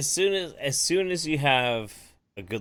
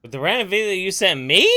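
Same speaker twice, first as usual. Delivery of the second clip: louder, higher, incredulous rising question; double-check it in loud disbelief, pitch climbing; 8 semitones higher, 7 dB louder than usual. Real, not acted.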